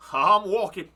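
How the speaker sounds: loud character take. Acted. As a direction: belt it out in character.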